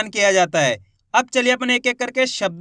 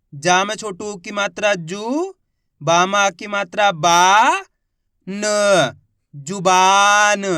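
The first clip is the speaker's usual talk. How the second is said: stretched syllables, then the word louder; slow sounding out; 3 dB louder, much slower than usual. Shown as a demonstration. Teacher sounding out a word for beginner readers, slowly. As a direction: drag each syllable, then land the word. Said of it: "teacher sounds out word".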